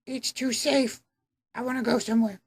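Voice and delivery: put-on very high, soft, whiny girl voice; a hammed-up bored teen complaining; whiny mock Emma. Mocking Emma whining about her safe town. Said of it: "Emma's like".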